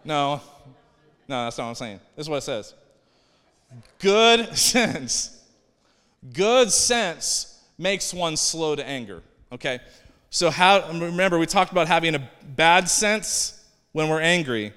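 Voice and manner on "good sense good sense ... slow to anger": emphatic scripture reading. Acted.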